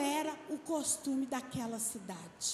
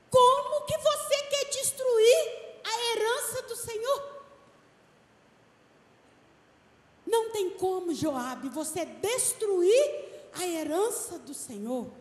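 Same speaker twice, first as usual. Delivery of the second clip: much higher, louder, proclaiming; fiery preaching; 6 semitones higher, 6 dB louder than usual. Acted. Wise woman challenging Joabe, shouted preaching. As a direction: cry it out to the church.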